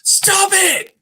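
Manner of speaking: sudden high yell, far above normal; shouted stop it. Retold or imitated. Psychiatrist yells at his patient across the desk, sudden high shout. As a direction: blurt it out hard, like snapping someone out of it. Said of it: "he yells across the table".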